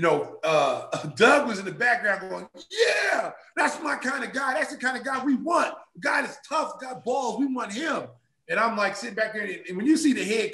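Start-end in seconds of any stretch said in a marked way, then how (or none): 2.7-8.1 higher, hyped-up approval; excited cheering. Retold or imitated. Head coach hyped in the background, higher excited cheer. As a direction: cheer him on like a proud coach.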